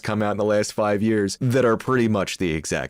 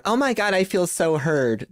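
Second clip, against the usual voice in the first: mock validated viewer; 9 semitones higher than usual. Background viewer agreeing with the video, mock gushing. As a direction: gush like someone finally validated.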